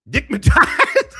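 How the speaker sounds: high, loud laughing blurt; bursting laugh. Real, not acted. Streamer reading a fan's name, loud high laughing blurt. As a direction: crack up at the name.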